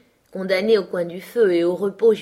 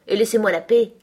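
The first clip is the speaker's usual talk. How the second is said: ferocious; 3 semitones higher, 6 dB louder than usual. A narrator's reading. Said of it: "s'écria-t-il d'un ton féroce".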